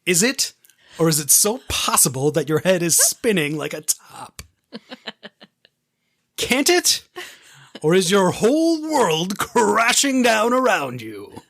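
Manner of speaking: rising mock theatrical taunt; hammed-up dramatic. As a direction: ham it up like a soap villain.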